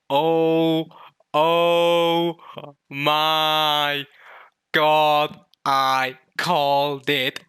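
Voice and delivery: loud, long-drawn words; overjoyed shouting. Real, not acted. Fan sees own prediction come true, ecstatic shout. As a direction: bellow each word, stretching it out.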